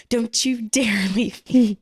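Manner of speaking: slow, emphatic scolding with a laugh; retold stern warning, drawn out through a laugh; mock lecturing. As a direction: imitate a husband laying down the law, half-laughing. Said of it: "were gonna be lectured".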